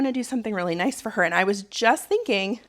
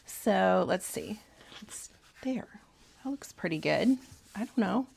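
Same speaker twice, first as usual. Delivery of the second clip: whispering to herself; 7 dB quieter than usual. Real, not acted. Muttering while adjusting pocket.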